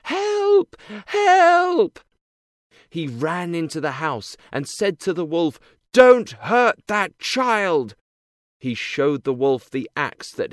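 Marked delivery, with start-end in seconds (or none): 0.0-2.0 loud, high cries for help; calling for help. A narrator's reading. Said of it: "when she called". 5.9-8.0 loud, slow shout. A narrator's reading. Man confronts wolf.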